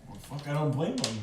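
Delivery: ordinary talk, nothing marked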